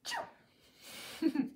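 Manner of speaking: quiet covered sneeze; a gentle polite sneeze; soft sneeze. Shown as a demonstration. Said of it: "we sneeze like this".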